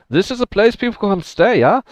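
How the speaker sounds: a bad German accent on purpose; mock German accent, asking. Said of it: "He says it in a much more German".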